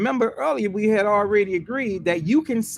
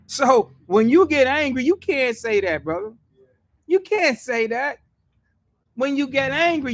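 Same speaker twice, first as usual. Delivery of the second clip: heated preaching; 7 semitones higher than usual. Real, not acted. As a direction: build the fire of the sermon. Preaching at full force.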